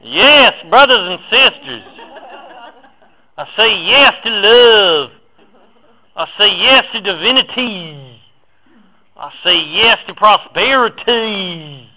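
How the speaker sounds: booming high Southern preacher voice, slow and drawn out; Southern preacher accent. Pretend. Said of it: "You gotta say it in a southern accent".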